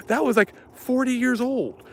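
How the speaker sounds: high mock outrage over old missiles; pissed-off prepper. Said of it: "He said".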